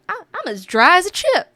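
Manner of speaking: high, loud imitated Southern twang; Southern accent. Earworm from the roommate's call, Southern accent. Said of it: "it's a little earworm that's like".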